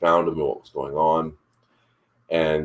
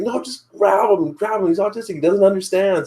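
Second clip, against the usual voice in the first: mock urgent yell, much higher pitched; retold yelling; 3 dB louder than usual. Ex retells yelling to strangers to grab boy, mild mock yell. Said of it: "she started just yelling at them".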